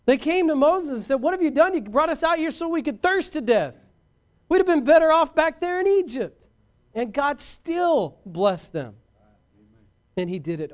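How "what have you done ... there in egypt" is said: high, loud acted grumbling; accusing complaint. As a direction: lay into the leader, voice pitched way up. Israelites' acted complaint to Moses.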